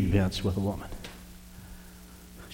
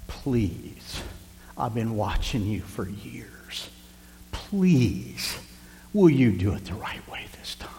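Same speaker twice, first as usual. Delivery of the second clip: slow, drawn-out "please", breath falling away; hushed pleading; 6 dB quieter, much slower than usual. Retold or imitated. Pastor kneeling before a woman in trouble, begging in a hush. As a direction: plead softly, close to a whisper. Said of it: "I pleaded with her".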